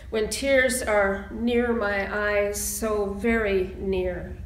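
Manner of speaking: slow repeated refrain. Acted. Minister repeats the refrain slowly for weight. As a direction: stretch the repeat out, dwelling on tears.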